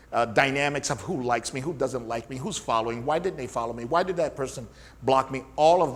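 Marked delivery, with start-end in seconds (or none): none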